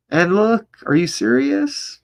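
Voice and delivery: high, loud disbelief; can't believe what he's seeing; incredulous outburst. Real, not acted. Disbelief at the numbers.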